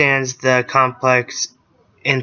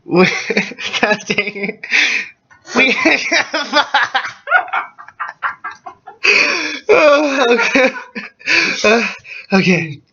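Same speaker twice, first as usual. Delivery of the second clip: laughing fit; 10 semitones higher than usual. Real, not acted. Breaks up laughing mid-reading.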